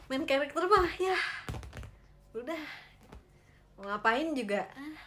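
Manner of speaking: loud, exasperated brush-off; dismissive. Pretend. Viewer who cares nothing for the main character, loudly dismissive. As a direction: brush them off loudly, half joking.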